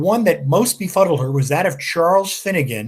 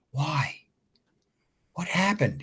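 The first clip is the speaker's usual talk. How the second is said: asked softly and much quieter; 8 dB quieter, slower than usual. Acted. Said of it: "he asked quietly".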